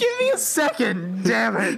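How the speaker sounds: high, choked-up plea for a moment; a weeping NPC holding himself together; crying man. Acted. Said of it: "got tears in his eyes".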